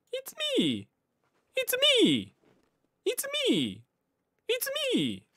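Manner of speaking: very high falsetto It's me; high voice test. Shown as a demonstration. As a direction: squeak it out way up high, testing the mic. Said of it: "高い声か？".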